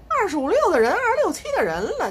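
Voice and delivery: high, loud indignant outburst. Real, not acted. Mother venting to viewers about her grown son, high and indignant. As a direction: fling out the son's age in outrage.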